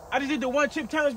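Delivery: ordinary talk, nothing marked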